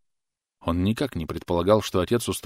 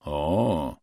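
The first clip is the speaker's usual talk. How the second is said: surprised drawl; 2 semitones lower than usual. A narrator's reading. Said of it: "удивлённо протянул Джордах".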